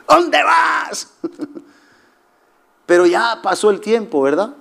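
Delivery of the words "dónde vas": scolding shout. Pretend. Preacher shouting at his younger self, where are you going. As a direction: call out to stop him going.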